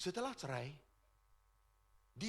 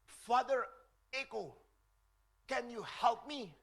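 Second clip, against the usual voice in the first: a hint of a pleading lady, higher and slow; 6 semitones higher, slower than usual. Lady knocking at priest's door, lightly voiced higher plea. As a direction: ask the priest sweetly for help.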